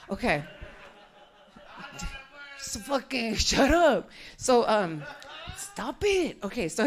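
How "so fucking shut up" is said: ordinary delivery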